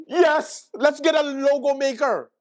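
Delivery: high, eager chorus of approval; mock excited agreement. Comedian as staff applauding the name change, mock excited. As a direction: cheer the idea on in mock enthusiasm. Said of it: "And everybody like".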